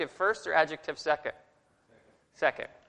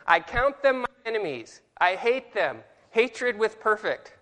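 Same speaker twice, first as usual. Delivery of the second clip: higher, slow on Hatred with perfect; dramatic reading; 6 semitones higher, 3 dB louder than usual. Shown as a demonstration. Teacher declaiming the verse to the class. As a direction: declaim it, stretching the last phrase. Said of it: "I'm gonna read it again".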